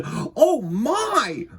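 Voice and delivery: high, loud shocked exclamation; alarmed, high-pitched "oh my"; startled gasp. A narrator's reading. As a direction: cry out as if running smack into a bear. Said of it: "gasped Mister Winky".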